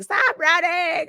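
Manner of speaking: high-pitched yelled plea, held back in volume; mock scream. Everyone in the car yelling at her to stop, mock high yell. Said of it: "everyone is screaming".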